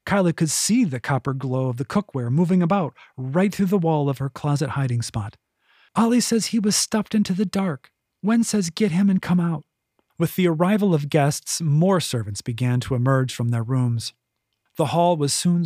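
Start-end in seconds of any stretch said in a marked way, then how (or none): 6.0-9.7 higher, urgent cat voice; Nack the cat. A narrator's reading. Nack relaying the cats' message to Kyla, urgent.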